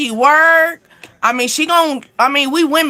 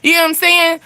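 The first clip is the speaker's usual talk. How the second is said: ordinary talk, nothing marked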